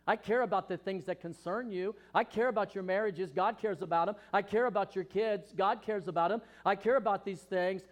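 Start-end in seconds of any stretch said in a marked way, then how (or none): none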